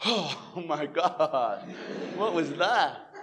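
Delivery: long drawn-out, reeling exclamation; mock-dazed, slowly exhaled amazement; stunned disbelief. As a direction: stretch it out as shock sinks in. Retells his shock alone afterwards.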